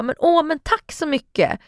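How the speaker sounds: slow, high-pitched, put-on thanks; artigt tacksam. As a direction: draw out a dutiful thank-you to hide disappointment. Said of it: "Man vill ju inte vara otacksam". (tillgjort tacksamt, ljust och utdraget)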